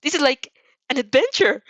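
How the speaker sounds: loud, high, giddy exclamation; giddy childlike excitement; excited. A narrator's reading. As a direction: gush with delight. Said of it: "She sounds so excited".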